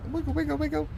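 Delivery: very high sing-song wiggle; squeaky playful chant; silly high voice. Pretend. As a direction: chirp it up high like a toy. Wiggling the craft.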